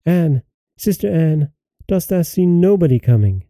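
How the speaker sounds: high, restrained call to a sister; crying out. A narrator's reading. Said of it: "she cried out".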